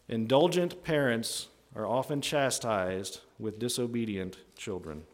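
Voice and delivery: slow it down phrase by phrase; measured delivery with pauses to let it sink in; spaced out slowly for emphasis; slow, deliberate repeat. Real, not acted.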